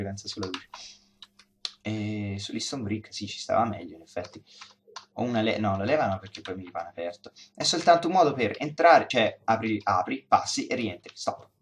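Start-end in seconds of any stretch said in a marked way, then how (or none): none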